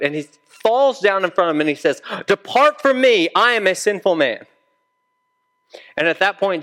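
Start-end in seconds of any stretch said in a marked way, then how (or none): 2.3-4.5 higher, urgent, scared plea; acted cry of a man falling down in awe; frightened plea. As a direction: plead fearfully, pitched up. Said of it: "more scared".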